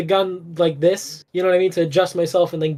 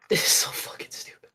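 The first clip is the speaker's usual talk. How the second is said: whispered laugh; 7 dB quieter than usual. Real, not acted. Laughing aside.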